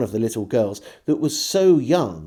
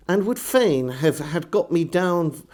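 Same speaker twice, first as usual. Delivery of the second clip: ordinary delivery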